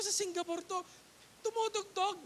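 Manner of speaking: high, amazed exclaim; surprised. Retold or imitated. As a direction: gulat na gulat, mataas na boses. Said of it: "gulat ako eh". (gulat na bulalas)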